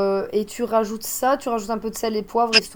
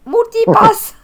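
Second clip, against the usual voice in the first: high, loud movie catchphrase; exaggerated squealed heroine impression; Lilou; 11 semitones higher, 9 dB louder, much slower than usual. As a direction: blurt it out high and loud, in character. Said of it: "l'image en dessous avec Lilou".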